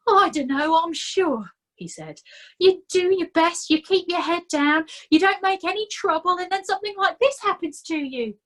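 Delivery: self-pitying complaint; whining Nobby. A narrator's reading. Nobby complaining to Fred about his bad luck, whining.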